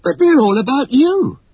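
high, surprised protest; high-pitched girlish exclamation; exclaiming. A narrator's reading. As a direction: blurt it out in astonishment. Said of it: "exclaimed Wendy".

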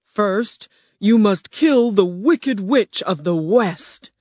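slow, booming beast voice of Oz; grand slow roar from a huge animal. Acted. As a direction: roar it out slowly like a great beast. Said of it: "the huge animal roared".

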